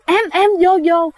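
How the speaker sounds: high, urgent mock call; frightened calling out. Husband calls his wife out of the sea, high frightened urgency. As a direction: hurry her in, retelling a scared husband. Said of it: "giống như là ảnh đang sợ một cái điều gì đó".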